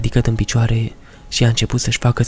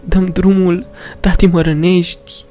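put-on pleading little girl; 8 semitones higher than usual. Daughter pinned down begs her father, high child's voice, hurt and pleading.

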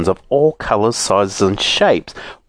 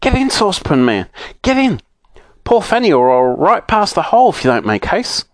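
urgent; 8 semitones higher than usual. A narrator's reading. Joe urging saucepan man.

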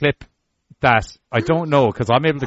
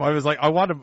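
normal talking voice

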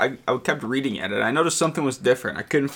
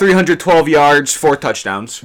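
loud, excited hype, much higher and louder; hyped pick; 4 semitones higher, 7 dB louder, slower than usual. Real, not acted. Hyped prediction.

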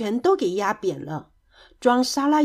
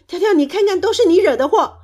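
high, loud blaming; shrieked-up accusation, loud; loud scolding; 8 semitones higher, 7 dB louder, faster than usual. A narrator's reading. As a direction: lash out at a friend in reproach. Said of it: "蹦蹦大声责备着".

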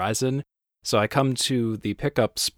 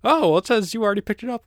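dismissive support agent; 13 semitones higher, 6 dB louder, much faster than usual. Retold or imitated. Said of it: "And they're like".

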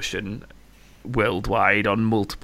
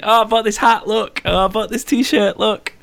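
high gushing fan, showing off; excited fan voice; 11 semitones higher, 4 dB louder than usual. Retold or imitated. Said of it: "like, saying".

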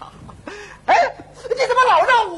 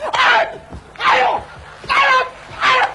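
yelps of pain; 6 semitones higher, 3 dB louder than usual. Acted. Being slapped by robot.

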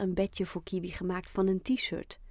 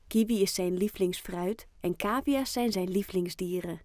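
usual speaking voice

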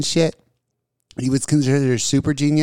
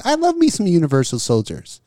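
usual speaking voice

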